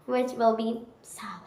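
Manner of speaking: whispered aside; whispering. Shown as a demonstration. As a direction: drop to a whisper. Teacher whispers silent.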